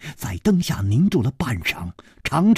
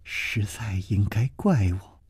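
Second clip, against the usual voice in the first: ashamed whisper; 6 semitones lower, 6 dB quieter than usual. A narrator's reading. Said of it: "柳无眉垂首说".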